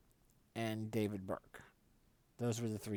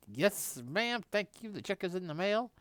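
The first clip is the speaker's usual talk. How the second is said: cheeky thanks; 10 semitones higher, 4 dB louder than usual. Retold or imitated. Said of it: "I was like".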